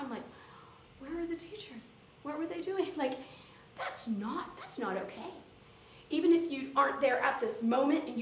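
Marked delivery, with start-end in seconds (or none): 1.0-2.9 higher, hushed disbelief; appalled questioning. Retold or imitated. Teacher reacting to a student's bullying story, appalled. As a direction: ask it aghast, pitched up and softer.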